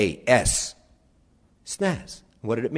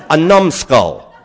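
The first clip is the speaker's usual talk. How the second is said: laughing punchline; 13 dB louder, much slower than usual. Real, not acted.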